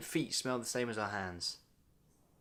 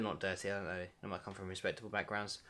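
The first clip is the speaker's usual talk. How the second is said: muttered aside; 6 semitones lower, 12 dB quieter, faster than usual. Acted. Hedging about the buyers, quiet aside. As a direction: lower and softer, backpedalling.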